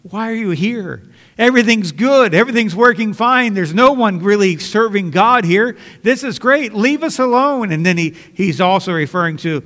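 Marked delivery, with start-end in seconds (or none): 0.0-7.7 higher, louder mocking demon voice; a smug demon waving the preacher away; voicing the demon. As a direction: sneer like a demon that feels at home. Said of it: "that's what the demon's saying".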